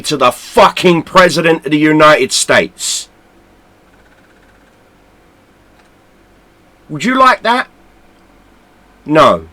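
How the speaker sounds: loud, high, shouting rant; real angry yelling at the sellouts; furious shout. Real, not acted. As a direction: yell it at them, furious. Ranting at the company, swearing.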